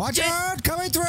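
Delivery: high-pitched "coming through" holler; calling out a warning. Pretend. Norman warns people as he rolls through, calling out high.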